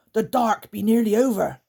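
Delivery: anxious creature warning; creaker voice. Acted. Guff warns the creakers, loud high creature voice.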